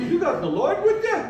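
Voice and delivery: high incredulous question; a touch of mock astonishment; mock disbelief. Drunk driver incredulous question.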